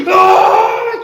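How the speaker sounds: chillido agudo y fuerte; grito de monstruo. Acted. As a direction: grita indignado. (monstruo ofendido grita)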